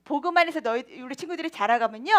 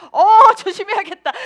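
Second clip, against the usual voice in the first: loud, high exclamation of alarm; acted wary startle; startled caution; 5 semitones higher, 10 dB louder than usual. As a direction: play a driver suddenly on guard. Acts reaction on seeing novice sticker.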